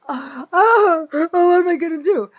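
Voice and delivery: high, loud, hammed-up gasping wail; mock despair. Mocking her own helplessness before the viewers, hammed-up wail. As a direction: fake a helpless panic, then drop it. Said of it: "I'm gonna keep talking".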